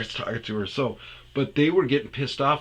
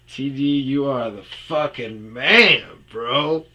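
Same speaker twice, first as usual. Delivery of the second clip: higher, louder, slow bro praise; excited praise; 5 semitones higher, 9 dB louder, slower than usual. Retold or imitated. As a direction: hype up the boss. Praising boss loudly.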